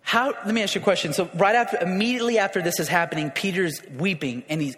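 ordinary talk, nothing marked

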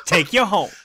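giggling through the line; amused mock echo of the hype man; laughing echo. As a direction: laugh while quoting it. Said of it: "you're like my hype man".

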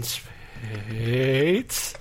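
Spates held very slow, sliding upward; drawn-out name. Pretend. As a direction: obediently stretch the vowel of Spates. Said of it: "say it like that every time".

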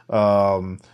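ordinary talk, nothing marked

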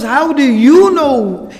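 loud, high, drawn-out disbelief; shocked. Retold or imitated. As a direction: cry it out in astonishment. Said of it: "he's so shocked".

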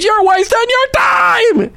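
high, slow preaching shout. Real, not acted. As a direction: belt it out from the pulpit.

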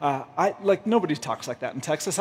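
low, softened mock confession; hushed imitation of a confidant; confiding. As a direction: let him in on a secret, quietly. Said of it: "total confidence, he told me".

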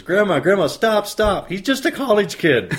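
put-on high, bright child pleading through a laugh; little girl voice. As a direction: squeak it out like a giggling little girl. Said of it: "the little girl says".

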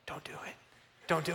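hushed, barely voiced plea; frightened whisper. Kid in the van pleading under his breath, whispered. Terrified child watching driver open drink.

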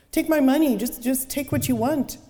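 high, loud, stammering begging; terrified pleading. Acted. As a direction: beg for your life. Man begs his attacker.

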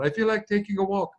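high, thin sketch-comedy voice; Monty Python. Acted. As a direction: pipe it up as a hammed-up comic character. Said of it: "That Monty Python".

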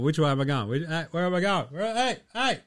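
lightly acted confusion building to a cry; imitating confused self. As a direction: play yourself losing balance on the couch. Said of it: "I'm watching him on the couch".

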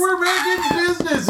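high-pitched imitation of triumph; gleeful mock exclamation. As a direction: crow it as the excited brothers. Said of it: "and they're like".

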